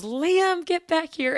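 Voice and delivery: loud, high imitated holler; dad yelling. Dad to kid flying down the ramp, yelled. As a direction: shout like a dad after a runaway kid.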